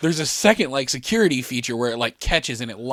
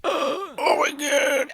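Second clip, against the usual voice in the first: squeezed high, slow strained wheeze; mock breathless gasp; much slower than usual. Rider crushed by the latched seat, mock breathless. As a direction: force it out with hardly any air. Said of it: "I can barely fucking breathe".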